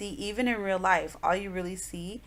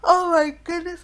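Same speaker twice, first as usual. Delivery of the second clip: words stretched through a real yawn, pitched up and louder; 12 semitones higher, 9 dB louder than usual. Real, not acted. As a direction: talk right through a yawn. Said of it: "I am so sorry for that yawn".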